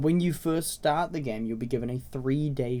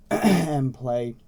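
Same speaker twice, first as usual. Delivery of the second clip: hoarse from a cold; a little raspy, worn by a cold; 5 dB louder, slower than usual. Real, not acted. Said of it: "so if I sound a little raspy".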